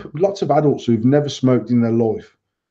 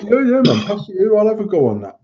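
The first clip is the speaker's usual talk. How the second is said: put-on higher, keen teenage yes; eager acceptance, imitated; 7 semitones higher than usual. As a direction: act out jumping at the offer. Acting out his eager younger self.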